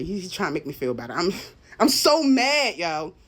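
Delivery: ordinary talk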